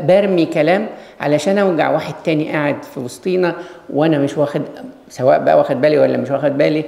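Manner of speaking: normal talking voice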